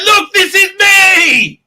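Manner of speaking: high, slow, indignant shout; outraged Tom Cruise. Retold or imitated. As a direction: hold up the proof and yell. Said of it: "like, said".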